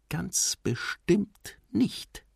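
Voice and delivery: put-on high, very slow child's thought; inward thought. A narrator's reading. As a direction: think it to yourself, drawn out and high. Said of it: "dachte Nemo". (langsam, hoch, zu sich selbst)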